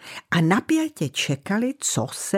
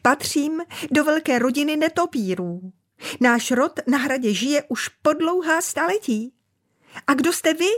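much higher squeaky bat voice; thin squeak; 9 semitones higher than usual. Acted. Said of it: "vypískl tenkým hláskem". (tenký pisklavý hlásek)

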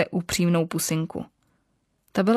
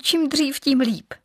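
eager; 7 semitones higher, slower than usual. Acted. Eager bride answers.

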